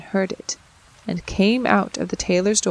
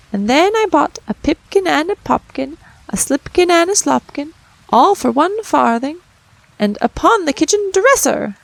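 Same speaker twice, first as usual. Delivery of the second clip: high, loud cheeky mouse voices; rude little mice; 7 semitones higher, 6 dB louder than usual. A narrator's reading. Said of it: "added the rude little mice".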